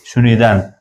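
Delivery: ordinary talk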